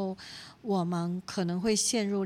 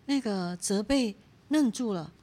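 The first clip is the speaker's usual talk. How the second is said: ordinary talk, nothing marked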